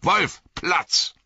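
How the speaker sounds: high, short called orders; calling commands to dogs. A narrator's reading. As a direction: call the dogs to heel. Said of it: "rief er".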